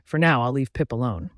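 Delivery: ordinary delivery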